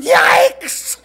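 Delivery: very high, loud drawn-out shriek; startled yell. A narrator's reading. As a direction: shriek like a horrified dad. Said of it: "turned around and yelled".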